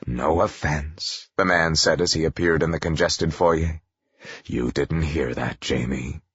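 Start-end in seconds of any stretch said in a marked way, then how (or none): 0.1-1.3 slow, low gruff father; lightly voiced gruff man, softer. A narrator's reading. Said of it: "a gruff man's voice". 4.4-6.2 low, slow gruff father; gruff man. A narrator's reading. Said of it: "a gruff man's voice".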